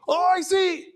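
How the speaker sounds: high, sustained cry of discovery; excited spotting. Acted. Safari-goer thinks he spots an animal, excited cry. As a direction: call out a sighting, pitch leaping way up.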